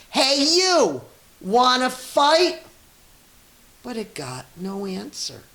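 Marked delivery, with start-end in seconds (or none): 0.1-2.6 high, loud challenge from a tiny bug; grouchy ladybug. A narrator's reading. Ladybug squaring up to the rhinoceros, high and loud. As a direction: squeak it out like a picking-a-fight bug. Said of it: "the grouchy ladybug said".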